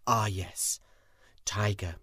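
ordinary delivery

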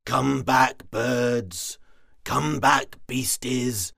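loud, slow calling. Acted. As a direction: call them back, loud. Frog calls everyone back.

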